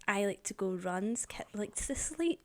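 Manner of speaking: soft, slow unvoiced whisper. Real, not acted. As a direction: whisper it as a teasing aside. Teasing aside whispered.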